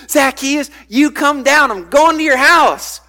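bright, friendly call up the tree; cheerful welcoming call; happy Jesus. Retold or imitated. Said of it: "There are some kids, it's like, Jesus is happy".

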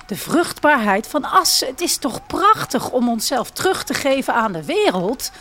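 a hint of a calm, idealistic young man; unruffled young man. A narrator's reading. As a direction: carry on as if nothing happened. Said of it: "gaat Olivier onverstoorbaar verder".

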